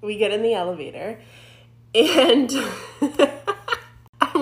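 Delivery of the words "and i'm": real nervous laughter breaking out. Real, not acted. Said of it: "nervous laughing just thinking about it".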